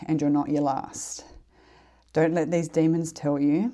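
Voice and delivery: ordinary delivery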